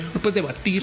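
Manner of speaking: ordinary delivery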